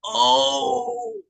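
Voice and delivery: collective taunting ooh. Acted. Other voices react to the gentle one's comeback, impressed ooh. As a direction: draw it out high, like onlookers reacting.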